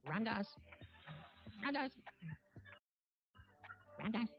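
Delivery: calling out. Retold or imitated. Guru calls Ram Dass across the courtyard, calling out. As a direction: call his name from far away.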